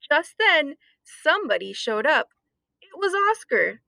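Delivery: ordinary delivery, nothing marked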